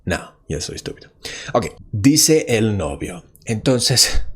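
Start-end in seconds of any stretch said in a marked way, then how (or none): 0.0-1.1 quick joking aside. Real, not acted. Self-mocking aside.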